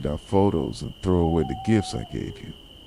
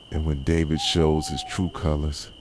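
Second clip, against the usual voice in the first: ordinary talk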